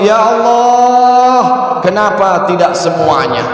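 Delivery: long high wail on Ya Allah, then settling; third cry. Retold or imitated. Husband's third dying cry, sung-out wail. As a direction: cry it out, then drop back. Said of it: "Baru yang ketiga dia bengok".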